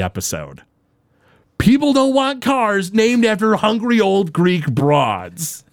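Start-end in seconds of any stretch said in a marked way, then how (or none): 1.6-5.6 slow, higher gruff put-on voice, a hint of exasperation; Herb flipping out. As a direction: imitate a fed-up boss spitting out the line slowly. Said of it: "Herb flips and delivers".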